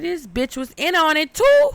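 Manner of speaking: shocked realization. Retold or imitated. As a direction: blurt it out as the truth hits you. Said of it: "I said".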